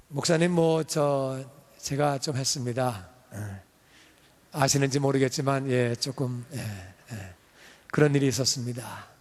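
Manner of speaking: low, soft, falsely humble murmur; mock modest boaster. Church worker to pastor, mock humble and soft. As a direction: play it down, fishing for praise.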